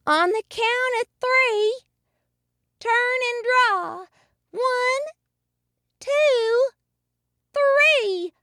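very high, drawn-out feminine character voice; saloon bobcat. A narrator's reading. Bobcat instructing the gunslingers, very high voice. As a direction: call the duel in a high, measured voice. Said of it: "A pretty bobcat from the saloon".

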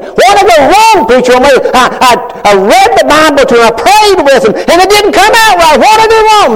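high, pained pleading cry; anguished plea. Retold or imitated. Heartbroken parent asking the preacher what went wrong, high and pained. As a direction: plead with the preacher, voice rising in grief.

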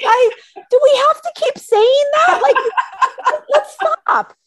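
very high, loud whine breaking into laughter; laughing mock exasperation; embarrassed protest. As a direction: beg to drop it, laughing.

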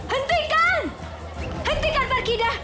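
high desperate cry to stop; shouting. Acted. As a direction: scream at him to stop. Woman stops the beating. (teriakan putus asa)

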